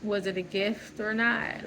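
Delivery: normal talking voice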